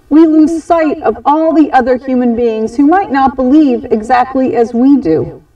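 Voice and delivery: plain reading voice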